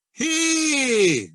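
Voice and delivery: high ringing call from the back of the mouth; practice shout. Shown as a demonstration. Teacher demonstrating shouts to students, high back-placed call. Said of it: "a couple of shouts".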